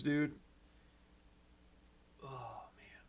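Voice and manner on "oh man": breathless whispered sigh; whispered, worn out by all the work; real whisper. Real, not acted. Overwhelmed sigh to viewers.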